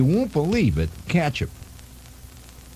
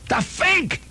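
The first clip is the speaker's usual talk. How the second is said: shocked exclamation. Acted. Repeated exclamation, high and loud.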